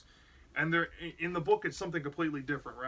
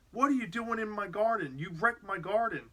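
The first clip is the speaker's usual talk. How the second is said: higher, raised indignant mock yell; mock angry neighbor; 5 semitones higher than usual. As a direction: play the outraged neighbor lightly. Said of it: "Mister McCall comes out and is like".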